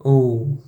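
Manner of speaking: long rounded Oh. Shown as a demonstration. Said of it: "like the O in more".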